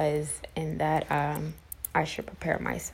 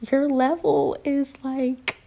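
high, louder mock-thrilled nurse; imitating excited nurse; 8 semitones higher, 5 dB louder, slower than usual. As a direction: gush it out as the nurse sharing good news. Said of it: "I'm so excited".

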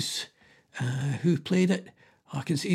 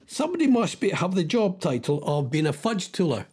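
ordinary talk

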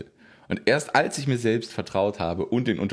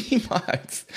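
laughing, much higher pitch; 12 semitones higher than usual. Real, not acted. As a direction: laugh out niemals. Laughing repeat of niemals.

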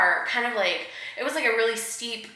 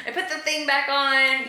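laughing through the words; giggling while talking; 7 semitones higher, 4 dB louder than usual. Real, not acted.

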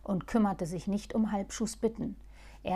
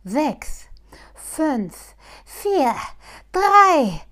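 high, loud rocket countdown; excited countdown; 6 semitones higher, 12 dB louder, much slower than usual. Acted. Halbschuh counting down to the mouse's escape, high and excited. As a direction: count down like a launch, thrilled. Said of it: "zählte Halbschuh wie bei dem Start einer Rakete".

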